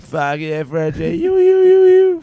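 high hollering fans, building to a loud whoop; imitated cheering crowd. Blacktown fans cheering the comedian's joke, imitated whooping. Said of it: "quarter of the crowd be like".

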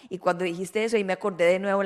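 normal talking voice

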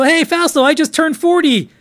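high, excited younger self; mock happy greeting; excited self-quote. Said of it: "I go".

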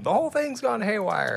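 high-pitched mock cry of alarm; hammed-up high holler; off-screen bystander. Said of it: "it was just somebody off screen".

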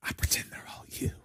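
fierce whispered shout; whisper yelling. Pretend. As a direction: hiss it like a yell without voice. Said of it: "Start whisper yelling".

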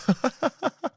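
high, soft giggling fit; helpless high laughter; laughing. Real, not acted. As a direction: crack up at your own story. Cracks up at the retort.

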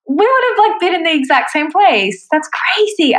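high-pitched, thrilled disbelief after a gasp; excited amazement. Real, not acted. Guest amazed at nearly crossing paths with the host, excited. Surprised at near coincidence.

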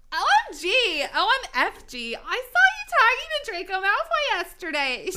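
high, loud, over-the-top excited squeal; excited valley girl. Acted. Goth girl gossiping about Draco, wildly excited. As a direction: gush like a hyped-up valley girl. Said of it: "she said excitedly".

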